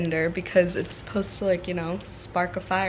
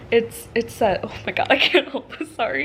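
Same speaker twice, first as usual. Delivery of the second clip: normal talking voice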